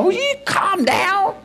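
wife telling him off. Retold or imitated. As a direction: plead with him to calm down like his wife. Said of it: "she calls at me from the door".

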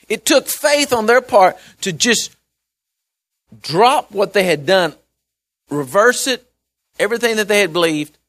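high, loud, impassioned sermon build; climbing into a fervent preaching roar; full-force preaching. Acted. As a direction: preach it out, rising to a cry.

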